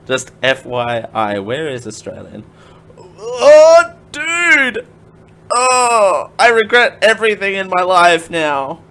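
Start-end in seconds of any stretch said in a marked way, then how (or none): 3.0-8.8 mock despair. Australia missing from medal list.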